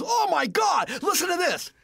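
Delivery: high, loud retold amazement; excited shout down the phone; thrilled outburst. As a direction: mimic a blown-away teenager. Imitates friend's thrilled phone reply.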